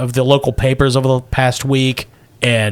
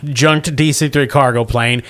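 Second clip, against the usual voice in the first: ordinary talk, nothing marked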